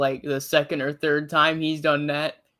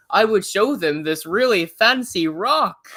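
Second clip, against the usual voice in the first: higher, louder, slow fancy faux-British accent; imitating an American pretending to be British; 5 semitones higher, 5 dB louder than usual. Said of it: "Think fancy accent".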